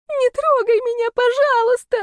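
high, loud frightened squeal; hammed-up terrified plea; desperate pleading. A narrator's reading. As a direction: beg for mercy in a squeaky panic. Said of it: "взмолилась девица".

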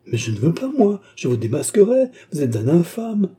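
outraged denunciation; indignant cry. A narrator's reading. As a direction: cry out in defiance, pitch leaping up. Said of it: "s'écria la jeune fille indignée".

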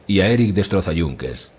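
ordinary delivery

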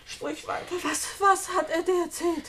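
breathless whisper, barely voiced. Julia begs Edna to go on, whispered. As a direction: whisper it, dreading the answer. Julia dreads hearing the gossip.

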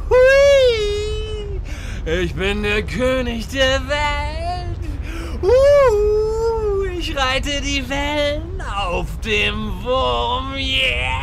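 high whoops, slow triumphant boast; hammed-up thrill-ride whooping; wild glee. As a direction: ride it like a rollercoaster. Riding the worm in glee.

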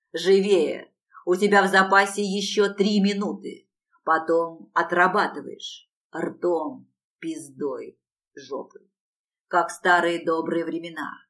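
ordinary delivery, nothing marked

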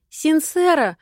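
high little squeak of a name; squeaky call. Acted. Richalya squeaks for Sincera nearby, high and bright. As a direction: pipe up like a tiny flower girl. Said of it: "писк Ришальи".

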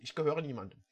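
softly repeating the line, no acting; plain soft repetition; quiet re-quote. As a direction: murmur it back to yourself.

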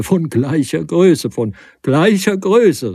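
loud, high, slow mocking echo; mocking cry. A narrator's reading. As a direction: throw the phrase back, laughing at him. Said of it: "rief er".

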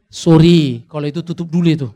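sudden loud, quick "Sorry", cutting the topic off; joking loud cut-off. Acted. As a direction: shut the idea down with a loud mock protest. Pastor cuts off a joke request.

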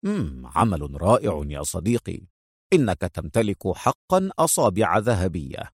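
low, softly impressed murmur of praise; admiring. A narrator's reading. Agent praising his forger friend's work, low and admiring. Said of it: "يقول في إعجاب".

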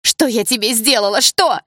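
loud, high cry climbing at the end; anguished accusation. A narrator's reading. Polina confronting Sasha over supposed lie, anguished accusation. Pained accusation against friend.

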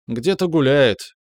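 tipsy, slow and carefree; lazily drunk answer. A narrator's reading. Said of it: "Пьяная".

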